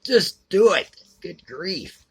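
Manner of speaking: high exasperated yell, easing off after the burst; exasperated scream. Retold or imitated. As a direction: yell it at the dithering heroine, fed up. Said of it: "I was screaming at".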